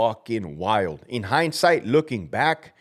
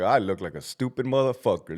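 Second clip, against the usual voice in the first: usual speaking voice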